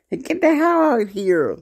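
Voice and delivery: high-pitched retold yell at the man in the truck; mock screaming. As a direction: shriek it like an angry young woman pounding on a truck.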